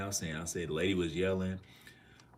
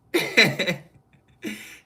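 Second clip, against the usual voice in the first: loud laugh; 14 semitones higher, 15 dB louder than usual. Real, not acted. Streamer laughing at the story about his neighbors, a loud high laugh. Laughs again after "seriously.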